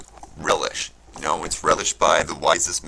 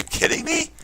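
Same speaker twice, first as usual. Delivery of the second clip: real voice crack of disbelief; 4 dB louder than usual. Real, not acted. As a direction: voice cracks up into a squeaky high pitch. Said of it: "I didn't wanna fucking get that little high-pitched voice".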